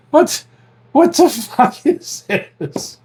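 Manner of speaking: high, slow retold bafflement; baffled disbelief. Retelling his discovery of another prank, high drawn-out disbelief. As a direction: draw it out high, utterly baffled.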